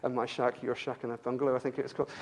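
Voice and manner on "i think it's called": usual speaking voice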